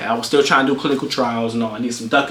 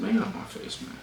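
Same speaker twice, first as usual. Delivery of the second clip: very soft, whispery brush-off; muttered dismissal; 8 semitones higher, 15 dB quieter than usual. Retold or imitated. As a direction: mutter it under your breath. Retold reply to dad, muttered.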